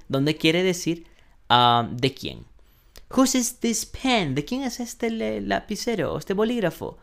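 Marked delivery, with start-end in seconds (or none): 3.1-7.0 asking voice. Pretend. Teacher playing a student asking about a pen. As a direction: lightly play a curious student.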